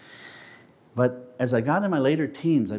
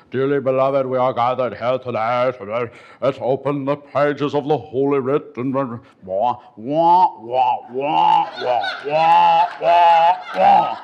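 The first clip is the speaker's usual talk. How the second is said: louder, grand mock sermon; pompous preacher; 6 dB louder, slower than usual. Mocking the Tenth Presbyterian preacher, loud and pompous. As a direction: parody a golden-tongued orator droning on. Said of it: "mocking a church orator".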